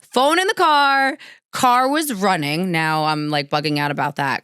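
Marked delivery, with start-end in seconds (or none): 0.0-2.7 alarmed. Retold or imitated. Panicked recounting of locked car.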